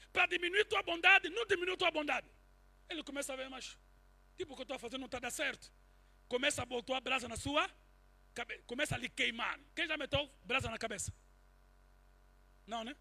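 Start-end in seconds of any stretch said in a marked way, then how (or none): none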